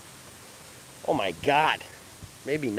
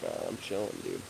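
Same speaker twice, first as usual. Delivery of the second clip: lazy animal voice; 10 semitones lower, 13 dB quieter than usual. Pretend. Voicing the resting babirusa to viewers, low and lazy. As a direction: put on a sleepy, deep critter voice. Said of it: "He's like".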